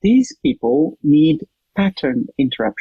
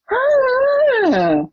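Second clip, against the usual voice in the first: high startled "Ah!"; a sudden high gasp of realisation; surprised gasp. Retold or imitated. Client's exclamation again, retold.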